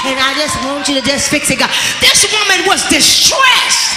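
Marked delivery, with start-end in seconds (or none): 0.1-1.7 a lightly voiced cutesy, lazy prayer; mock a half-hearted pray-er. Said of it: "patty caking God and thinking you all cute".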